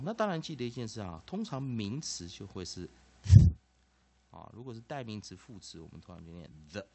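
normal talking voice

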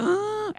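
high, strained choked grunt; choking noise. Retold or imitated. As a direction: mimic trying to speak with a blocked windpipe. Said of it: "I'm having a hard time breathing".

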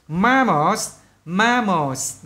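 "Mammals" said twice, ending S clear; English word demo. Shown as a demonstration. Teacher drilling vocabulary, clear English pronunciation. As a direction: model "mammals" carefully. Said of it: "Đó bạn nghe kỹ chưa?".

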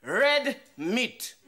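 loud, high and very slow, word by word; emphatic demand. Acted. Husband telling his wife what he wants for dinner, slow and domineering. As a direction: hammer out each word as an order.

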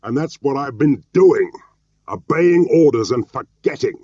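loud, high-pitched angry shout; angry, shouted retort. A narrator's reading. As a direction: hurl it back at full pitch. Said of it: "Jim was shouting at this point".

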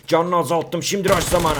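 usual speaking voice

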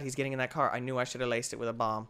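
ordinary talk, nothing marked

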